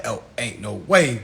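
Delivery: normal talking voice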